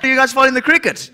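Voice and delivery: normal talking voice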